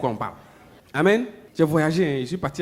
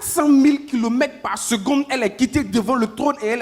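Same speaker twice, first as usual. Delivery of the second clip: preaching at full force; 8 semitones higher, 5 dB louder than usual. Acted. Preacher to church, the answer arrives fast: fervent preaching. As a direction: drive the point home to the congregation.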